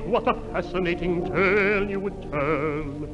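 ordinary talk, nothing marked

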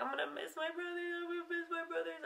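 high, hushed, teary whimper; tearful crying. Retold or imitated. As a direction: let the tears choke it as a child on a plane. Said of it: "I remember crying on that plane".

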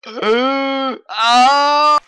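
loud strained grunts; pained groans. Real, not acted. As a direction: grunt out in frustration. Player groaning in game.

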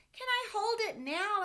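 put-on high, loud pleading child voice; eager little boy. Sam begging his dad to hold the kite, high eager child voice. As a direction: beg like a small kid who wants a turn. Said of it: "asked Sam".

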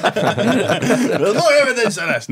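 high, loud mock protest through laughter; indignant Christians. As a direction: protest like an affronted believer, drawn out. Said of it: "listen to that story and go".

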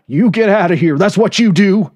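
high, loud ordering voice; angry cook. A narrator's reading. Cook ordering the narrator to leave, angry. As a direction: order him off the lot. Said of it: "the short order cook said".

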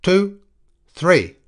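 loud, high, furious threat; howling. A narrator's reading. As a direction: howl threats through a door, counting him down.

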